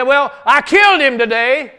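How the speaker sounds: loud high boast. Acted. David boasting to the Jebusites about Goliath. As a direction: drop the news proudly.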